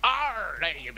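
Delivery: hammy pirate, rising; pirate accent. Acted. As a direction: arr it up like a sea dog. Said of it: "keep up with the accent".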